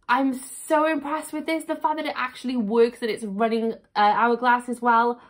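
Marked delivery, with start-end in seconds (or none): none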